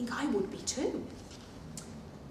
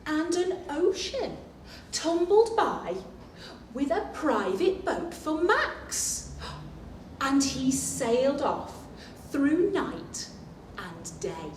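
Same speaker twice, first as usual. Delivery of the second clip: lively read-aloud storytelling voice; storybook narration; 4 semitones higher, 4 dB louder than usual. A narrator's reading. Teacher reading the picture book to children, storytelling.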